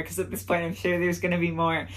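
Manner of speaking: ordinary talk, nothing marked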